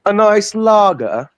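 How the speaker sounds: high, slow mock-British "a nice lager"; posh British accent. Mocking British pronunciation.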